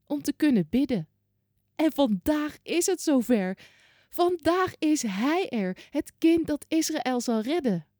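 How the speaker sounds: put-on high, quivering voice of a very old woman. Hundred-year-old Hanna thanking God in the temple, high and joyful.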